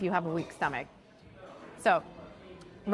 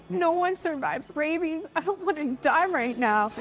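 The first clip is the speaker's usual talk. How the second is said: tearful panic; 7 semitones higher than usual. Retold or imitated. Crying in hospital, scared of rabies.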